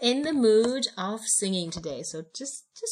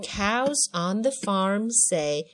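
slow, drawn-out read-along with long moos; slow lyric modelling; 6 dB louder, much slower than usual. Shown as a demonstration. Teacher modelling farm lyrics for a child, slow drawn-out chant. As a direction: stretch each moo out, loud and steady.

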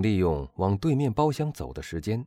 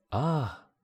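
exclaiming; 8 semitones higher than usual. Acted. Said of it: "阿尔贝大声说道".

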